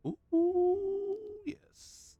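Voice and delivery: high, loud falsetto ooh; a giddy high-pitched ooh; excited squeal. Real, not acted. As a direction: coo with delight at the find. Delight at finding food.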